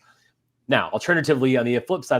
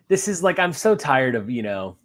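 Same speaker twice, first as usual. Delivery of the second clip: normal talking voice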